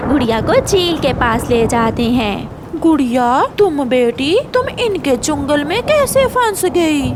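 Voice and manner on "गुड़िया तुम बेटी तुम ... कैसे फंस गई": lightly voiced concerned hawk, pitched up; hawk character. Hawk asks the little sparrow how she got caught, high voice. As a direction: ask it gently but high, as the hawk.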